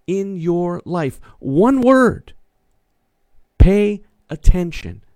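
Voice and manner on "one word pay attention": high, loud, drawn-out key phrase; preaching emphasis. Acted. Pastor drives his one word home to the congregation, loud and high. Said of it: "pastor's key word".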